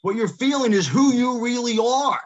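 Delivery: loud and high, pressing the words "who you really are"; emphatic declaration. Real, not acted. Coach stressing a key point.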